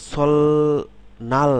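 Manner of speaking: সলনাল drawn out very slowly, each syllable apart. Shown as a demonstration. Teacher spelling out সলনাল slowly, syllable by syllable, with a rising tune. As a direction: stretch সলনাল slowly, with a separate n. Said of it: "syllable অনুসারে বললে সলনাল".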